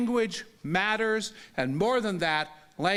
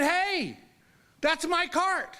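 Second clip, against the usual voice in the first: higher-pitched indignant call; startled-sounding objection, pitched up; calling out in protest; 9 semitones higher, slower than usual. Retold or imitated. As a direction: call after someone taking your things. Calls after guard taking cart.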